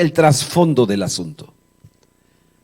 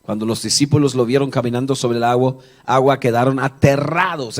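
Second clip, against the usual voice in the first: ordinary delivery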